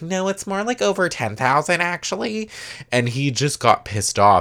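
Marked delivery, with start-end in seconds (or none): 0.0-2.5 a pitched-up, snippy correction; high mocking Scheana voice. Quoting Scheana correcting Brock about money, mocking high voice. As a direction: mimic Scheana, pitched well up and sassy.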